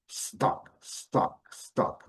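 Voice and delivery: stock started straight on s with no i before it; stock without i. Shown as a demonstration. Teacher correcting the Brazilian i before s. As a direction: begin stock directly on the s. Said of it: "o ideal é colocar um C".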